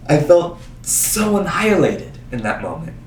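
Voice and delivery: ordinary talk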